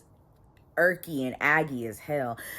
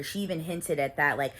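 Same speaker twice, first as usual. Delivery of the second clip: usual speaking voice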